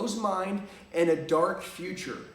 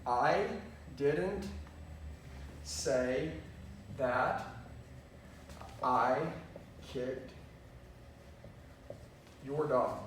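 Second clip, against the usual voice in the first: each word pulled apart with long pauses, falling lower; slow, word by word; 4 semitones lower, 7 dB quieter, much slower than usual. Shown as a demonstration. Coach showing a sentence said ten ways, dragged word by word. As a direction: lay out the sentence one word at a time. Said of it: "it can be used to ten different ways".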